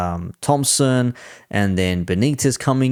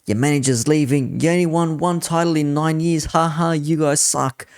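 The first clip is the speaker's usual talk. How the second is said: mock jeering; 6 semitones higher than usual. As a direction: imitate rival fans taunting, pitched up. Voicing rivals' jeers.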